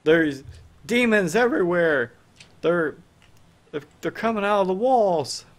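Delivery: high, loud cry of alarm; screaming townspeople. Acted. Game master as townspeople fleeing demons, crying out in alarm. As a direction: cry out a panicked warning. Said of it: "you know, people screaming".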